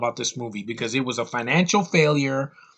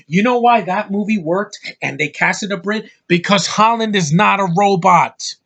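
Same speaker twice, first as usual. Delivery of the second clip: loud emphatic; 5 semitones higher, 5 dB louder than usual. Real, not acted. Heated rant.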